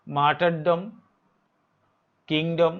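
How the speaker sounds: normal talking voice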